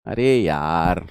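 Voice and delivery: अरे यार stretched very slow and low, half sighed; drawn-out exasperated sigh. Real, not acted. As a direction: groan अरे यार out slowly, worn out. Teacher exasperated, repeats the complaint. (बहुत धीमे, थकी हुई आह के साथ अरे यार)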